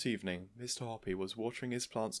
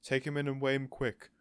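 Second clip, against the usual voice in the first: louder, pressing command; urgent order; 5 semitones higher, 6 dB louder than usual. A narrator's reading. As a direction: hurry her along. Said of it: "Mister Hoppy ordered".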